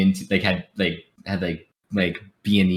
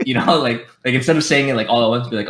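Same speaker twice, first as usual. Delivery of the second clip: ordinary talk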